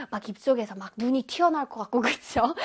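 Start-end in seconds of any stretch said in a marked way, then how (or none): none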